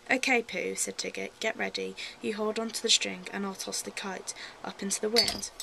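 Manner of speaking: put-on reading a children's story aloud; lively storybook reading; story narration. Reads a Winnie the Pooh story.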